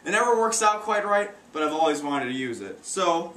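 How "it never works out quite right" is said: usual speaking voice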